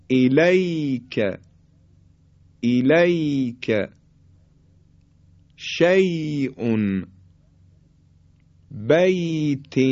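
"الیک", "شیء", "بیت" with slow, smooth ay glides; soft līn. Shown as a demonstration. Teacher models ya after fatha in "بیت", soft līn. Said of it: "به خاطر نرم و خیلی راحت ادا شدن".

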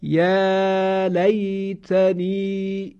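very slow, drawn-out recited words; recitation drill. Shown as a demonstration. Teacher leading a slow recitation drill. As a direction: chant each word slowly for students to repeat. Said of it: "شما هم حتماً با من تمرین کنید".

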